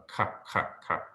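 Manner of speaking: short, soft throaty ka, between C and H; guttural sound demo. Shown as a demonstration. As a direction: isolate the ka, made in the throat. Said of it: "it's produced kind of in the throat".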